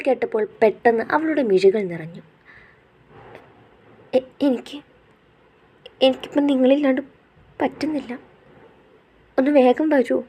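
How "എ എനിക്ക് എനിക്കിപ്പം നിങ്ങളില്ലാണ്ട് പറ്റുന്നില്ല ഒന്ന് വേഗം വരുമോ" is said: higher, breaking, halting voice on the edge of tears; a choked-up plea to her husband; tearful plea. Acted. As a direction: beg him to come back as the tears start. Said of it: "അവൾ കരഞ്ഞു പോയിരുന്നു".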